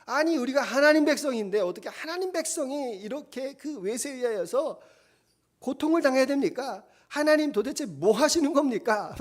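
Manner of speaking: complaining lament. Retold or imitated. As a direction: grumble loudly at God, half-pleading. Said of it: "투정을 하는 겁니다".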